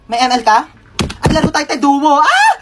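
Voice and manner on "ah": sudden very high scream; hammed-up yelp; high comic shriek. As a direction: shriek mid-game. Nurse shrieks during game.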